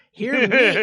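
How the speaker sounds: loud announcement. Acted. Announcing a cover.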